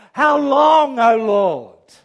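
loud pleading cry to God. Acted. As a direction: cry it out to heaven.

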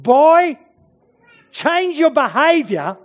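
high, forceful command; stern commander. Acted. Alexander points at the cowardly kid, commanding sternly. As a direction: order the boy like a ruler.

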